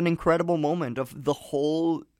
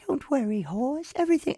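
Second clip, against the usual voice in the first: put-on much higher, softer British child voice; mock British kid reassuring a horse; British boy; 9 semitones higher, 5 dB quieter than usual. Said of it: "and they're British, by the way".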